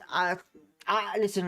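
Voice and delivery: ordinary delivery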